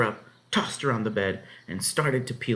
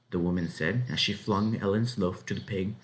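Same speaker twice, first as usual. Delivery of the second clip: plain reading voice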